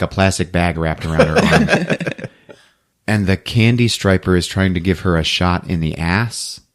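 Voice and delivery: usual speaking voice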